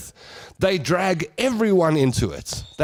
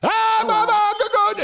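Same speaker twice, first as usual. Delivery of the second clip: very high mock Italian tirade; hammed-up Italian-accented outburst; Italian shouting. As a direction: rant like an outraged Italian, high-pitched. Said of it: "if they're shouting at you in Italian".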